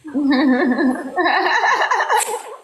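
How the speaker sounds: bursts of laughter; laughing. Real, not acted. Laughing at the word game.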